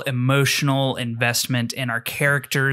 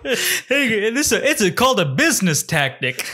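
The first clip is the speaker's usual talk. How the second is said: lantern guy voice; 8 semitones higher than usual. Retold or imitated.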